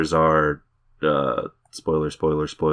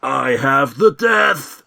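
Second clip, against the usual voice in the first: dying hero; 8 semitones higher, 4 dB louder, much slower than usual. Retold or imitated. Mocking He-Man's dramatic death.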